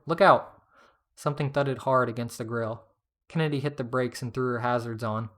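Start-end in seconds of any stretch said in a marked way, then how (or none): 0.1-0.6 loud, higher-pitched shout; shouted warning. A narrator's reading. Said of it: "Sydney shouted".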